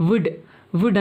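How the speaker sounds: "వుడ్" with a silent L; short, separate "వుడ్" ending on D; correct pronunciation. Shown as a demonstration. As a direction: drop the L in "వుడ్". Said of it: "వుడ్ అని పలకాలి".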